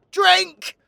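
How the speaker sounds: sharp, high shout; shouted command. Acted. Storyteller orders the listeners to drink, loud shout. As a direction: bellow it as an order.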